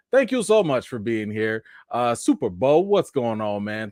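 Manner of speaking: usual speaking voice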